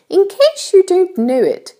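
high-pitched storybook ladybird voice; kindly ladybird. A narrator's reading. Ladybird explains things to James, kindly high voice. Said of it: "obviously a kind and gentle creature".

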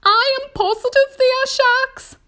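very high, loud insistence; alarmed earthworm. A narrator's reading. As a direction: insist in a high, panicky character voice. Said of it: "cried the earthworm".

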